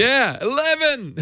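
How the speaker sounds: slow, high eleven in a mock Scottish accent. Echoing the Scottish lift video, mock Scottish eleven. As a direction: stretch out eleven like the angry Scotsman. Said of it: "you start yelling at it".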